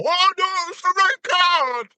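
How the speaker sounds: high, hammed-up shocked cry; mock outrage. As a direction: squeal it like fake outrage. Mocking ref's dramatic red card.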